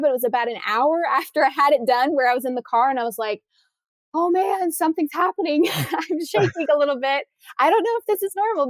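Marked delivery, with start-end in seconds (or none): 4.1-8.7 high, unsettled retelling of shaking; retold shaky panic. Shaken in the car after tongue tie surgery, high mock alarm. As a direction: replay the rising alarm in a lifted voice. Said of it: "I kinda got a little shaky".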